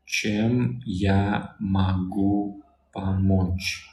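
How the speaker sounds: чем я могу помочь said as one very slow phrase; very slow whole phrase. Shown as a demonstration. Teacher says чем я могу помочь whole and very slowly. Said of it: "how can I help you".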